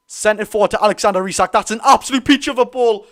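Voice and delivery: excited shout. Real, not acted. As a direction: yell it out as the pass lands.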